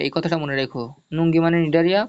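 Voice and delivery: ordinary delivery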